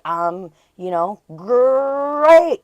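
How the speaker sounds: "great" stretched high and loud after a slow pause; imitating coworker's big "great"; drawn-out emphasis. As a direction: hold back, then belt "great". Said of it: "he'll be like".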